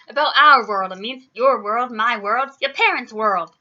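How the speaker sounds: loud, punched list; exasperated, forceful explaining; emphatic exasperation. A narrator's reading. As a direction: hammer each item home. Giant spells it out, exasperated.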